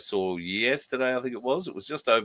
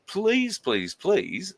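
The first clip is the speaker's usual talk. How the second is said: 1 mock pleading; 12 semitones higher, much slower than usual. Real, not acted. Begging winner to reply.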